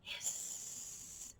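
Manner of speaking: soft breathless hissing snake; voiceless snake hisses. Shown as a demonstration. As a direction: hiss like a snake. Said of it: "Time to be snakes".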